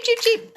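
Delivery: high-pitched chick cheeping; chick cheeps. Retold or imitated. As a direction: squeak it out like a tiny hatchling. Imitating hatching chicks' cheeps.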